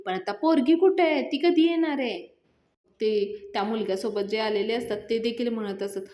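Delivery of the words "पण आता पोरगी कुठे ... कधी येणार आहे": higher, louder impatient questions; angry demand. Retold or imitated. Groom to the family, tired of waiting: angry demand. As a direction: demand it, losing patience. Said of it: "तो खूप चिडलेला असतो".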